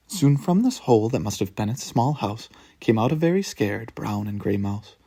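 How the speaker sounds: ordinary delivery, nothing marked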